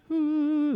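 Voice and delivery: high mock ghostly ooh; a playful haunted-house ooh; mock ghost wail; spooky ooh. Mocking a floating apparition.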